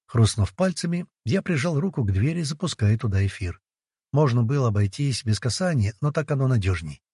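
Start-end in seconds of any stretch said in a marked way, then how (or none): none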